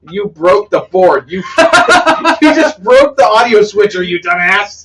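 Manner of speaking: high, laughing scolding. Real, not acted. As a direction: scold while laughing hard. Laughing at broken switcher.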